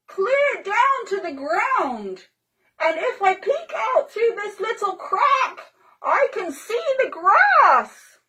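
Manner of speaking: put-on high, slow delighted child voice; Pippi from inside. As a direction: marvel from inside the hollow. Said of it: "they heard Pippi's voice".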